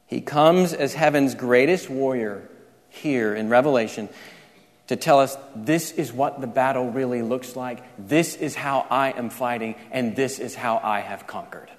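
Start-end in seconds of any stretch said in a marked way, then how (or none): 5.5-11.7 low, soft voice of Christ revealing; quietly solemn declaration; hushed quote. Acted. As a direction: speak it low and softly, letting the end sink. Voicing Christ revealing the battle.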